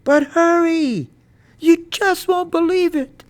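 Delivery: high, loud urgent hollering; excited shout. A narrator's reading. Boy urging Mama up the hillside, shouting. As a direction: shout it urgently, stretching the hurry. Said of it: "I shouted".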